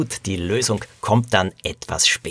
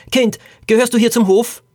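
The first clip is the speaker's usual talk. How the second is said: high, raised voice, calling across; man calling to child; 12 semitones higher, 4 dB louder than usual. A narrator's reading. Stranger in the stable questions a child, calling out high. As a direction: question a child sharply higher and louder. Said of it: "fragte eine Männerstimme".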